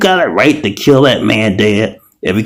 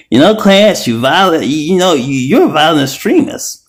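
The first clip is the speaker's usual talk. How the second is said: imagined FBI agent; 8 semitones higher than usual. Pretend. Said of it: "FBI can come to me and tell me".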